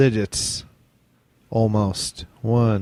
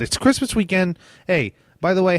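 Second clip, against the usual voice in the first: ordinary delivery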